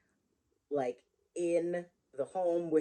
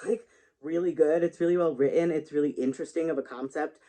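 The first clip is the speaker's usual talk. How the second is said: ordinary delivery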